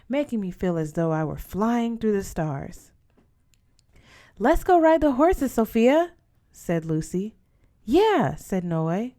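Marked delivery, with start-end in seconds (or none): 4.4-6.1 put-on higher, louder kid's plea; a child begging to run to the horses; eager child. Little Lucy begging her sister to ride, excited. 7.8-8.4 eager yes. Acted. Noe chimes in eagerly.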